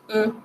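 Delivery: "E" as French "eu", lips pushed forward; closed rounded sound. Shown as a demonstration. Teacher showing lip position, closed rounded sound. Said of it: "sonido cerrado".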